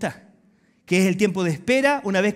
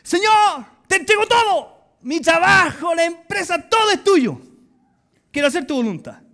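high, loud and slow shouting to God; passionate surrender prayer; 11 semitones higher, 7 dB louder than usual. Retold or imitated. Preacher reenacting his reckless prayer at home, shouting high. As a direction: hand everything over at full cry. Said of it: "y me pongo así".

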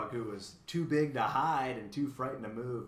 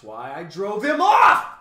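loud, slow cry swelling high at the end; fierce, crowing claim of victory; boastful cry; 7 semitones higher, 18 dB louder, slower than usual. A narrator's reading. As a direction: build to a shouted boast. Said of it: "cried the bug".

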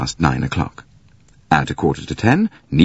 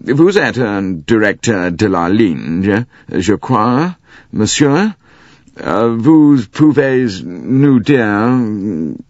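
careful British French; 4 semitones higher, 3 dB louder, much slower than usual. Acted. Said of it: "careful British French".

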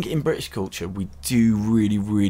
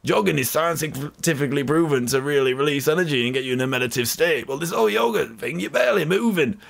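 higher, blustering bloke scoffing at yoga; a cynical British scientist ranting, pitched up; scientist impression; 5 semitones higher than usual. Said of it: "so that's my scientist impression there".

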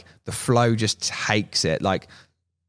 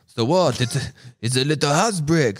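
put-on higher French-accented shrug of a question; light French accent, higher and amused; mock French accent; 5 semitones higher than usual. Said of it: "it's my French".

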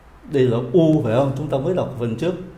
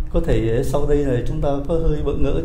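ordinary talk

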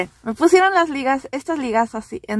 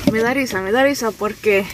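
ordinary talk

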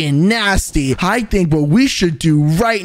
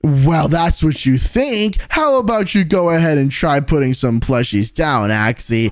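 usual speaking voice

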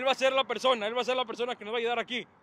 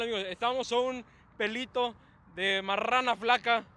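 normal talking voice

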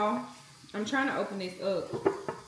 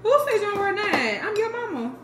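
scolding mama; 8 semitones higher than usual. Real, not acted.

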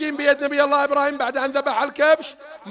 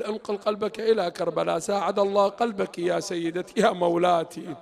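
softer, lower, grieving plea; mournful lament; 5 semitones lower, 4 dB quieter than usual. Real, not acted. Preacher addressing Fatima al-Zahra in grief. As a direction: mourn as you address her.

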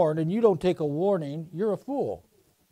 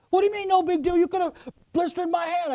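much higher, indignant voice of the burned man; outraged burned man; 10 semitones higher, faster than usual. Retold or imitated. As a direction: squeal it out like the man whose palm got singed. Burned man protests, voiced high.

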